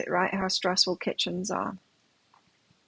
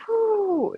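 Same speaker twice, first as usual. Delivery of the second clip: relieved whew; 12 semitones higher than usual. Retold or imitated. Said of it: "He was like".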